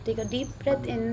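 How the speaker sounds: soft, slow breathing guide; right in your ear. Acted. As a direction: gently guide each breath, slowly. Leads a breathing meditation.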